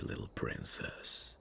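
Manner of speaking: soft whispered farewell, slowing down; tender whisper. Acted. Prince alone, saying goodbye to his princess, whispering. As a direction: breathe it out as a final goodbye. Private farewell after she's gone.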